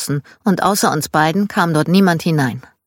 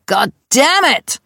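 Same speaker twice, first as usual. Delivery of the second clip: higher, louder, slow drawn-out God damn it; loud slow curse; 8 semitones higher, 4 dB louder, slower than usual. Retold or imitated. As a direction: bellow it down the hall, fed up. Dad cursing from his room.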